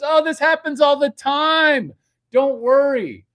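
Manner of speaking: very high, very loud mock girlfriend voice; girlfriend reassuring. Girlfriend covering for the clog, shrieked very high and loud. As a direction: shriek it like a frantic hostess.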